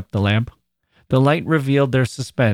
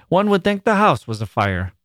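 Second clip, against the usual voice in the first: higher, relieved mild chiding; relieved exclamation; 8 semitones higher than usual. A narrator's reading. Mother after her daughter's startling cry, relieved. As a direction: let relief out as a chide.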